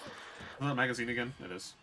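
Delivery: usual speaking voice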